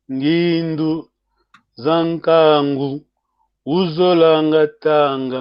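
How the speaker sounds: slow, each word set apart by a pause; slow for repeating. Shown as a demonstration. Teacher models the Kikongo passage slowly for learners to repeat. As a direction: read it slowly so the class can echo. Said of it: "vous répétez".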